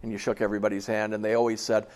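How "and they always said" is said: ordinary talk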